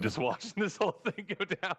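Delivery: ordinary delivery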